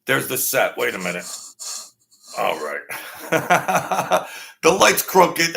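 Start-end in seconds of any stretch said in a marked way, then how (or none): 2.4-5.5 laughing. Real, not acted. Laughs at crooked light setup.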